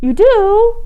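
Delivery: high, loud delighted question; delighted surprise. Real, not acted. Reading host to listeners.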